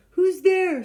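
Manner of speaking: high, loud startled call. Acted. Stuck elephant to the unseen visitor, loud call. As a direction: call up into the dark. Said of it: "elephant calls into the dark".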